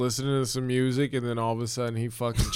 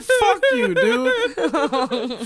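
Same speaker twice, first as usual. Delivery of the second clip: very high, loud, laughing protest; mock-outraged comeback; 6 dB louder, much slower than usual. Player swearing back at rude kid, mock-outraged. Acted retort to insulting kid.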